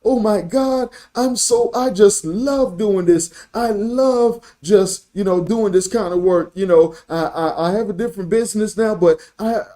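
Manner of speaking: high-pitched gushing phone-agent excitement, imitated; excited young lady. Preacher mimics young lady on the phone, excited. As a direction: imitate someone bubbling over with passion for the job. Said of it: "the way she talked, she was like".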